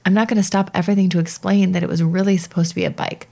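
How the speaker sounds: ordinary talk, nothing marked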